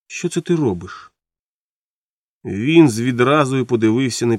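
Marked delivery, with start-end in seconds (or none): 0.1-1.1 mildly surprised, higher and softer; a soft, lifted note of surprise; surprised question. A narrator's reading. Wife finds husband being sick, asks in mild surprise. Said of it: "здивовано спитала".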